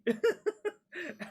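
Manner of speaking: real high-pitched laugh; high burst of laughter. Real, not acted. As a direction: let out a sudden high laugh. Laughing at own story.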